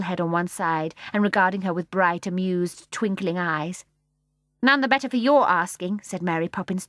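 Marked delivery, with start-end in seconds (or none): 4.6-6.0 tart. A narrator's reading. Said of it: "tossing her head".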